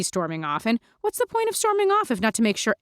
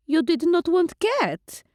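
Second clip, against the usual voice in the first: higher, slow, puzzled; vampire Lulu, accented; 9 semitones higher, slower than usual. Acted. Lulu asks Mandy why she skipped dinner, accented and puzzled. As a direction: ask like a doting old-world vampire.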